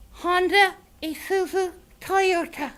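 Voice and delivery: chirpy little dragon saying hi; silly puppet creature. Acted. Grork fakes Japanese with car names.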